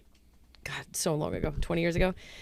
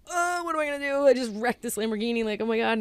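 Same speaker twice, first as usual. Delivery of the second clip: put-on panicked kid; 6 semitones higher than usual. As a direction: imitate a kid who has wrecked a car. Kid panicking over wrecked Lamborghini.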